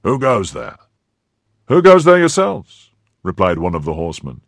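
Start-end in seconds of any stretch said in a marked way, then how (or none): 0.0-0.8 firm call, a touch raised; firm challenge. A narrator's reading. Athos challenges two horsemen at night, firm. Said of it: "cried in a firm voice". 1.7-2.9 loud, high shouted challenge; shouted retort. A narrator's reading. Horseman shouts back at the musketeer, loud retort.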